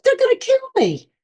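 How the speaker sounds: very high-pitched retold panic; mock alarm. Patient after oxygen tank falls on her, alarmed. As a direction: pitch it way up, then drop at the end.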